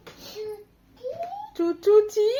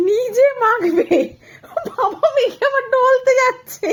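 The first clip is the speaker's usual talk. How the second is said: amused laugh; 9 semitones higher, 4 dB louder, slower than usual. Real, not acted. Laughing at baby oiling herself.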